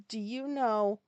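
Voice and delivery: loud, drawn-out WHY rising at the end; rude outburst. Retold or imitated. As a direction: let "why" swell into a long, loud demand. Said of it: "That's exactly how I said it".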